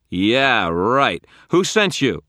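loud scoff rising to a shout; scoff, then fire the question; scornful demand. A narrator's reading. Wizard scoffs, then demands answers.

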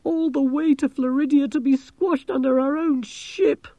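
high, wailing moan of complaint. A narrator's reading. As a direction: bemoan the doom in a high voice. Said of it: "moaned Angelo".

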